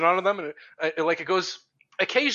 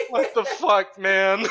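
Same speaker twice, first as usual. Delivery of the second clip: higher, laughing disbelief; stunned disbelief; 6 semitones higher, 4 dB louder, much slower than usual. Retold or imitated. Broken survivor on his knees, stunned. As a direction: gasp it out in shock. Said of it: "just like".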